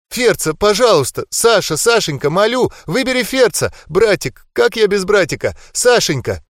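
high, tearful begging; tearful pleading. A narrator's reading. Girl begging Sasha to save her brother, tearful plea. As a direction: beg through tears for her brother. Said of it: "К слезам Толы".

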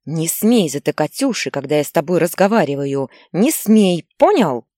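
scold, then explode on the last не смей; building angry shout. Acted. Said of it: "кричала". (мать всё громче кричит на сына)

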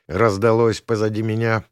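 usual speaking voice

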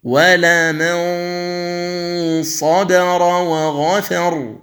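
ينظرون drawn out with the nasal hum held; slow tajweed recitation. Shown as a demonstration. Teacher demonstrating ikhfa before ظ, slow recitation. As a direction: chant ينظرون slowly through the nose. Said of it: "عند الصاد".

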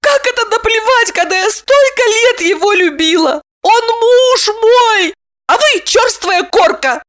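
very high, squealing tearful outrage; squeaky woman, wounded and scolding; indignant wronged wife. A narrator's reading. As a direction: lament and accuse in a high squeak.

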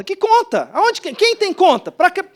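high, loud incredulous questioning; mock bafflement. Pastor poking fun at his own regional saying, incredulous. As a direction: demand an answer in comic disbelief.

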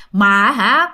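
ordinary talk, nothing marked

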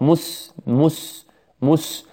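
مس with a thin meem and forward rounded lips; light meem. Shown as a demonstration. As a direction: say مس softly with a light, unthickened م. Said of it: "حرف الميم مرقق". (نطق مس بميم مرققة)

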